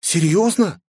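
high, startled question; surprised young woman asking. Acted. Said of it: "Хранительница выпучила глаза".

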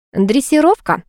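pleased, amused boast; smug humph. Acted. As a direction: toss it off smugly. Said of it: "хмыкнула Зуфарова".

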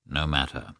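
soft, low murmur to oneself; quiet inward thought. A narrator's reading. Karenin thinking to himself as a visitor arrives, quiet.

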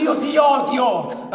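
slow, loud, exasperated yell through laughter; laughing shout. Acted. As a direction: yell it while cracking up. Exasperated at partner's loss.

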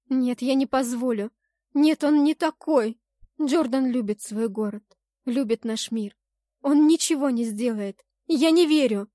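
insistent, upset denial; agitated protest. A narrator's reading. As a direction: defend him desperately. Heroine tearfully defends her beloved.

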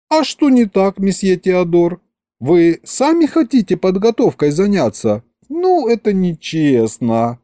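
put-on high, girlish indignation; protesting vampire girl. A narrator's reading. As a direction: object in a high voice, a little put out. Masha protests to monsieur Theodore.

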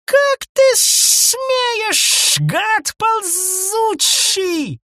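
very high, slow raging shriek; furious shriek. A narrator's reading. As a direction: shriek it in outrage. Wife shrieks at husband. (визгливая ярость)

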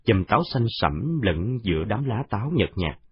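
usual speaking voice

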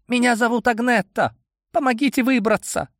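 put-on high pleading girl's voice; frightened girl. A narrator's reading. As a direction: beg through the door as a scared girl. Trapped girl begs through door.

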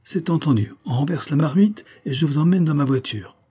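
high-pitched, beaming announcement; joyful exclamation. A narrator's reading. Évonime arriving to take her to the ball, exuberant. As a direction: burst in cheerfully, voice lifted. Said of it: "la figure épanouie, et s'écria".

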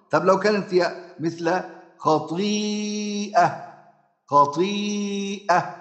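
خطيئة drawn out slowly with the hamza; tajwid demo. Shown as a demonstration. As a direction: stretch خطيئة as in recitation. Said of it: "أما أبدلها".

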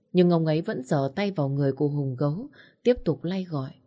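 plain reading voice

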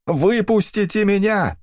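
very high, drawn-out screaming plea; frantic scream. A narrator's reading. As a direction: scream it at the guards, slow and desperate. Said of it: "истошно кричала Эдит".

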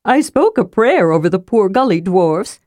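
solemn little character voice, lively pitch; earnest storybook sidekick, lightly solemn; Tasselhoff. A narrator's reading. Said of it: "said Tasselhoff solemnly".

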